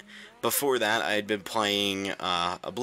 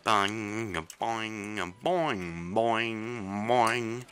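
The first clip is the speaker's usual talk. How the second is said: ringing "boing" sound imitation; drawn-out boing noises; 2 semitones higher, much slower than usual. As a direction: bounce out long, droning boings. Makes boing noises while playing.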